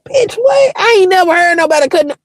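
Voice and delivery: high-pitched, fast indignant outburst; shocked disbelief. Retold or imitated. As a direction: squeal your disbelief at him. Acted shocked reply to the man.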